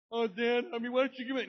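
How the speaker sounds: put-on high, loud whining child; mock whiny kid begging dad. Kids whining for something.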